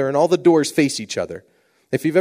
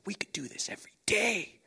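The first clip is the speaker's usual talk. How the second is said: hushed; 4 semitones higher, 14 dB quieter than usual. Retold or imitated. Kids answering in hushed awe.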